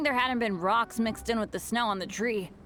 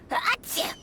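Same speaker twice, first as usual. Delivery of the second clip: sneeze; 10 semitones higher than usual. Acted. Sneeze after cold.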